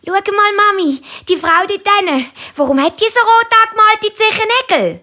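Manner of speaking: sehr hohe, neugierige Kinderstimme; Kind fragt Mami. A narrator's reading. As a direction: frag hoch wie ein kleines Mädchen. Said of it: "Tanja sagt zu Mami". (sehr hochi Chinderstimm)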